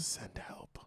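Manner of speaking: whispered mock plea. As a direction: whisper it like a hostage. Joking plea.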